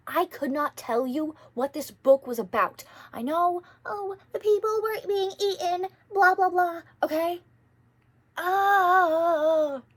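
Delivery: high, loud exasperated outburst; exasperated rant. Real, not acted. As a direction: throw up your hands, loud and high. Exasperated rant about hated book.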